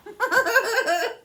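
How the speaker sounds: short high burst of laughter; high amused laugh. Real, not acted. Laughs at the manager arriving.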